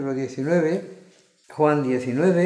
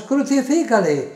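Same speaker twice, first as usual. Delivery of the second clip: much higher, louder crowd cry; louder crowd shouting; 10 semitones higher, 6 dB louder than usual. A narrator's reading. Crowd insisting against Pilato, forceful narrated shout. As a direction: escalate the crowd's demand into a strong shout. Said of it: "ellos gritaban aún más".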